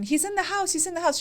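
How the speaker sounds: high but hushed pretend panic; a softly played frantic caller; mock panicked scream. Wife plays a caller reporting an intruder, mock panicked. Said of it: "you're screaming".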